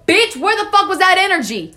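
high, loud indignant retort; indignant outburst. Real, not acted. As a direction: throw it back at them, fed up. Indignant punchline after fake kindness.

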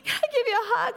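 a man in tears asking for a hug; tearful. Retold or imitated. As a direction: ask it while breaking down crying. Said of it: "He burst into tears".

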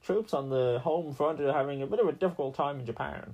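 put-on old newsreel announcer; a wartime radio announcer giving bad news from the front; World War Two radio newsman. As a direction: read it like a wartime radio bulletin. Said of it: "World War Two news radio guy".

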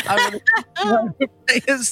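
giddy squeal. Shown as a demonstration. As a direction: blurt it out in a giddy squeaky rush. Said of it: "I've been standing for too long".

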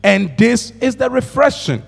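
slow, solemn reading of scripture. Acted. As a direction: read it slowly like holy writ. Reading Isaiah scripture aloud.

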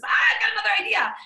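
a sudden, high, loud eureka outburst; excited exclamation. Acted. Coach voicing someone struck by another idea, excited exclamation.

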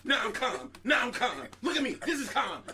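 yelling that she is calm, loud and high; mock yelling. Friend with anger issues yelling she's calm, loud mock. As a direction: yell "calm" while clearly not calm. Acted angry quote.

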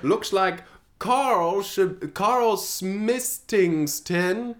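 high, very slow nun. Acted. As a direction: drawl it slowly and high. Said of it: "She says".

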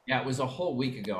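ordinary talk, nothing marked

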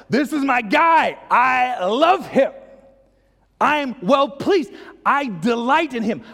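high, loud, slow shout of delight; exultant Father. Acted. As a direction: proclaim your love for your son at full force. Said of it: "the Father's like".

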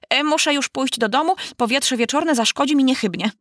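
put-on high fussy old magpie; lightly voiced fretful old bird; young person faking old. A narrator's reading.

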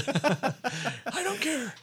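put-on high, drawn-out old-timer voice; hammed-up elderly defiance, stretched slow; stubborn old man. Old Corey refusing to leave the stage, old man voice. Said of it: "ninety-two-year-old Corey walking around with his cane".

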